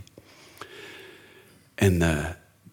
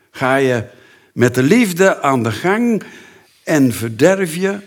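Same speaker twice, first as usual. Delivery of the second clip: louder, higher warning from the pulpit; rising warning; 5 semitones higher, 6 dB louder than usual. Acted. Preacher warns husbands in the church, raised voice. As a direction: build the warning up.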